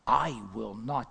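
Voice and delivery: slow, low, deliberate refusal; emphatic refusal. Acted. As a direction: weigh out each word of "I will not". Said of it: "The Hebrew is very emphatic".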